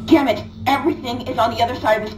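frustrated outburst. Acted.

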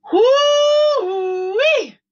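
high loud delighted cry; high excited holler; joyful whoop. Real, not acted. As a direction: let out a happy whoop. Delighted exclamation.

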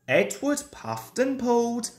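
plain reading voice

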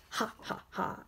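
breathy unvoiced ha ha; a soft creepy chuckle; quiet eerie laugh; spooky laugh. Spooky laugh before Halloween.

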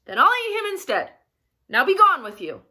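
loud, hurried dismissal; shouting troll. A narrator's reading. Troll sends middle goat off, shouting. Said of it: "he shouted".

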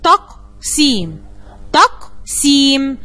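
very slow, each syllable of تقسيم pulled apart. Shown as a demonstration. Teacher to third graders, slow syllabified reading. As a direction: spell تقسيم out slowly in beats. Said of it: "الكلمة مقسمة إلى تلا".

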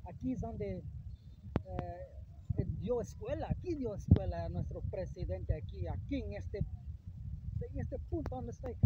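hushed awe. Real, not acted. Awed at president's spot.

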